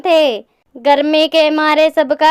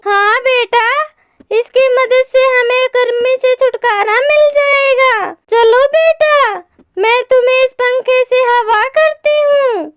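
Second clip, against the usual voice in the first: higher-pitched storybook mother; reassuring mother bird; 8 semitones higher than usual. Acted. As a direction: reassure your chick in a raised character voice. (दिलासा देती माँ चिड़िया की ऊँची आवाज़)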